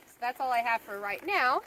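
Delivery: normal talking voice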